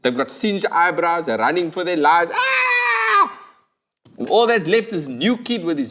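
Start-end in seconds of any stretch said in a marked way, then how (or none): 2.3-3.4 high fleeing yell; panicked scream. Acted. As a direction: scream as you run. People fleeing fire.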